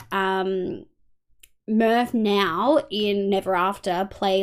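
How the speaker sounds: ordinary delivery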